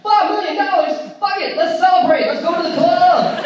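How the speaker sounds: loud, high hammed-up hype; rally everyone for a night out, mock-presidential; party excitement. Imagining himself as party-loving president.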